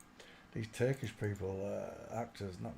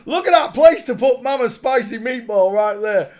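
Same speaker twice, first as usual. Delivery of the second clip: much louder and higher, laughing through the words; 11 semitones higher, 17 dB louder than usual. Acted. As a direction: crack yourself up as you shout the joke again. Repeats joke loudly while laughing.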